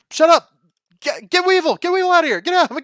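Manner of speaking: mock the duelist yelling "Get Weevil out of here"; squeaky mock yell. Mocking the anime's duelist ordering Weevil out, squeaky mock yell.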